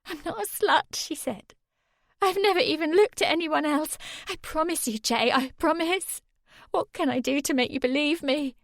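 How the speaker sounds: high tearful pleading; crying. Acted. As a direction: plead through tears. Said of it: "Sammy started to cry again".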